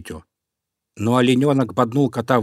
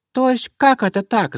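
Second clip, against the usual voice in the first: high-pitched indignant squeak; tiny mouse voice, pitched way up; squeaking mice; 13 semitones higher, 4 dB louder than usual. A narrator's reading. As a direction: pipe up like outraged little mice. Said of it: "запищали мышки".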